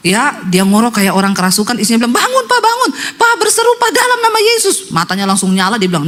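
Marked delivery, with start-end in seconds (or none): 2.1-4.8 high-pitched urgent calling; acted wife's cry. Wife calls collapsed husband awake, high-pitched cry. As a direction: cry out high to rouse him. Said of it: "Istrinya teriak".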